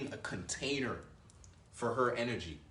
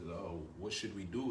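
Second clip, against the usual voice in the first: hesitant man; 4 semitones lower, 6 dB quieter than usual. Retold or imitated. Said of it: "You don't wait for her".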